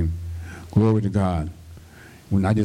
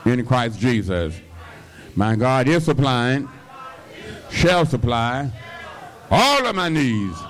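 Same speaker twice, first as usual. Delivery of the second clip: call-and-response declaration; 5 semitones higher than usual. Shown as a demonstration. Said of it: "Somebody say".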